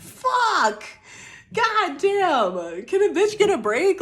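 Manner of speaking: high, loud cry of frustration, half laughing; exasperated outburst. Real, not acted. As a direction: throw it at the sky, fed up and amused. Venting exasperation while laughing.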